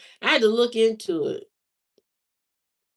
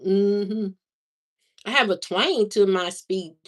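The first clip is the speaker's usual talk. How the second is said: ordinary delivery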